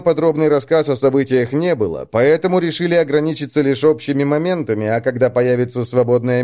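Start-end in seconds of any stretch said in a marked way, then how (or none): none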